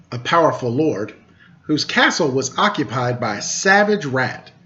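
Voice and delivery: ordinary delivery, nothing marked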